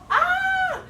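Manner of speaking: sudden high, loud gasp-cry; startled yelp. Acted. Voicing the startled child in the story, yelping. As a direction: jump up with a yelp.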